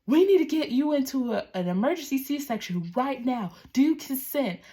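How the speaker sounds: high, loud urgent yell; retold yelling. Doctor yelling at the mother to consent now, high loud urgent yell. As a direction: bark the emergency at the patient. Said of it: "screaming at me".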